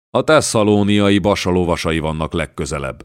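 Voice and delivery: ordinary delivery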